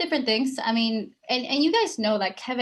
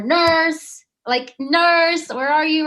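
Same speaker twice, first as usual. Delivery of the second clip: raised, higher-pitched patient's call; calling for nurse; 6 semitones higher, 7 dB louder, much slower than usual. Acted. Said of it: "the way you're calling".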